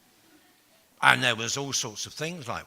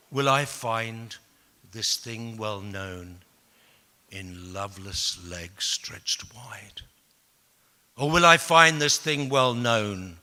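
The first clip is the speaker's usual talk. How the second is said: slow, drawn-out poetry reading; posh poem recital; 3 semitones lower, slower than usual. Acted. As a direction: recite grandly, lingering on each phrase. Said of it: "I was trying to be posh".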